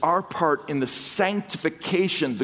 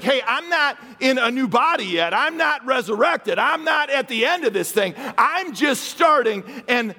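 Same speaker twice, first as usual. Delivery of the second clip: voicing Paul; 7 semitones higher than usual. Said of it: "So he's like".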